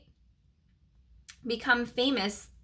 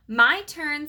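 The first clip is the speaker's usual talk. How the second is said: shouted; 6 semitones higher, 6 dB louder than usual. A narrator's reading. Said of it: "Simon shouted".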